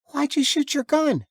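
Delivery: put-on high, puzzled child voice; little girl's voice. A narrator's reading. Daughter asking her father about the gunshot, high child voice. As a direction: ask like a small girl who won't budge.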